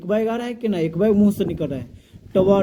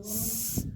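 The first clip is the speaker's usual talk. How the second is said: a long, much softer hiss like water heating up; hissing s sound; 4 semitones higher, 14 dB quieter than usual. Shown as a demonstration. Teacher imitating heated water for students, soft hiss. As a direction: breathe out a steam-like स hiss. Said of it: "पानी गर्म करते हैं तो कैसे करता है".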